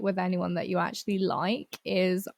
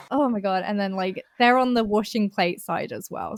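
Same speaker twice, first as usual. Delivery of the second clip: usual speaking voice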